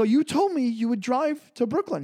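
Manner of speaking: mildly imitated outrage; retold protest. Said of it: "And I go".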